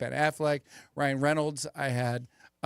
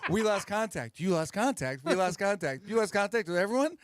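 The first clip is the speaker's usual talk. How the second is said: higher Wahlberg impression broken by laughs; Mark Wahlberg impression; 5 semitones higher than usual. Comedian redoing his Wahlberg bit for hosts, laughing through it. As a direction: repeat the lines, cracking up. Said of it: "I never noticed that about him, but when you do it".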